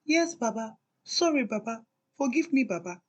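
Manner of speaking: lightly mimicking her own meek pleading; a hint of a cowering wife parroting herself; mock submissive wife. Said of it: "six years of".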